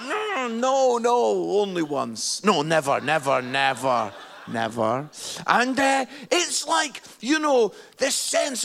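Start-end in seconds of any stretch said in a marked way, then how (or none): 0.0-5.1 loud hurried denial, laughing at the end; lightly voiced panicked teen. Teenage self caught by the preacher's finger, a touch of loud panic. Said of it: "And I was like".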